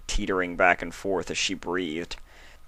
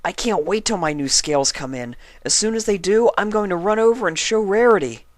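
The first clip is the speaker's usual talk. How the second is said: high, fast, eager, louder at the end; excited dragon; 13 semitones higher, 5 dB louder than usual. Acted. Spike dreams of showing Rarity his scales, excited and high. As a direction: gush about showing Rarity. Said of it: "Spike was excited".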